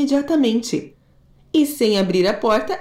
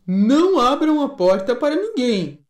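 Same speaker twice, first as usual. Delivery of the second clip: high, gentle mother goat voice; a caring mother goat, pitched up; 5 semitones higher than usual. Acted. Mother goat warning her kids, high and gentle.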